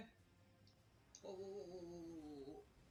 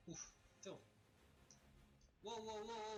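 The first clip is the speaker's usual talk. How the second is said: ordinary talk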